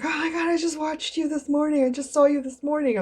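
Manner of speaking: high, loud imitated gushing; hammed-up high fan excitement; starstruck fan. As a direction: mimic a woman thrilled to spot you. Imitates woman who recognized her.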